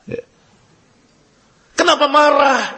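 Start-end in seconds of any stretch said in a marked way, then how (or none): 1.7-2.8 higher and louder, pointed question; loud rhetorical question to the listeners; rhetorical challenge. Shown as a demonstration. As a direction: challenge the congregation. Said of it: "Itu yang saya katakan".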